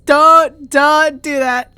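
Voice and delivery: loud, slow begging; loud slowed-down anguish; pained protest. Real, not acted. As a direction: plead with him not to. Reacting to his brave smile.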